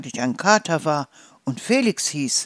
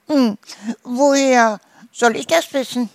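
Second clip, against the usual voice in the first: high, loud goose voice; helpless protest in a high squawk; whiny goose; 7 semitones higher, 5 dB louder than usual. A narrator's reading. Goose shrugs off farmer's questions, whiny.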